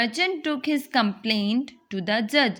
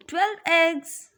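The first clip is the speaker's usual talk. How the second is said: higher, stressing twelve eggs; emphatic; 6 semitones higher than usual. Acted. Merchant stresses his loss.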